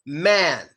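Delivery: Man with a wide open jaw; jaw-dropped man. Shown as a demonstration. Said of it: "You have to also drop your jaw".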